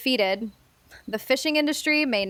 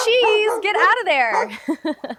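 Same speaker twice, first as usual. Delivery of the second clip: higher, louder, slow call to the dog; 7 semitones higher, 3 dB louder, slower than usual. Acted. Owner scolding her dog from a distance, slow raised call. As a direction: call the dog away from the mess.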